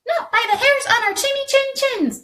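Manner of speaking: very high, fast piglet squeal; squealing reply. A narrator's reading. Three pigs answering the wolf in high squeals. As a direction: squeak it back quickly. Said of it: "the pigs replied".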